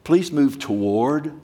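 slow, drawn-out plea, Please rising; slow pleading. Retold or imitated. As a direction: coax them forward gently and slowly. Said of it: "The word in Greek means".

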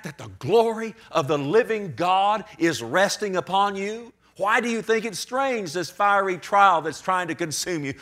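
ordinary talk

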